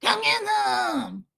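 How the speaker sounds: high, drawn-out eerie call; scary voice. Pretend. Pretending to scare a stranger, high drawn-out scary call. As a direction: stretch it out slowly, high and strange. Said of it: "scare the shit out of Brooklyn".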